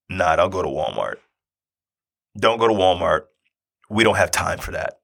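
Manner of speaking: deliberate retold refusal with weighty pauses; grave refusal. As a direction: calmly put your foot down, pausing between sentences. Gravely refusing wife's Walmart plan.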